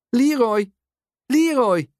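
high-pitched mother's call. A narrator's reading. Mum searching for her lost son, calling out. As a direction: call the child's name across a crowd. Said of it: "hoort hij een bekende stem".

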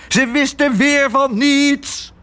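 very high, drawn-out enraged yell; furious shouting. Acted. Father raging about the clerks to his daughter, furious high shout. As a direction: scream it out slowly in outrage. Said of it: "schreeuwde Tos".

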